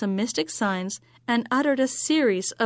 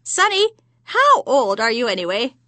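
louder crone voice; old crone voice; 2 semitones higher, 5 dB louder than usual. Acted. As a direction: needle him like an old crone. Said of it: "the repulsive crone turned to him and said".

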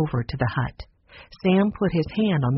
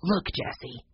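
soft, hushed call; whisper; 4 semitones higher, 7 dB quieter than usual. Acted. Henry drawing Jessie aside in secret, hushed voice. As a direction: drop the voice so only Jessie hears. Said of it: "whispered Henry".

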